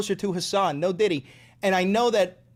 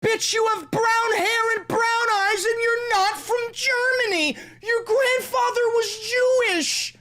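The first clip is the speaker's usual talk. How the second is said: mock outraged rant; 12 semitones higher than usual. Ranting at Hitler, a high outraged shout.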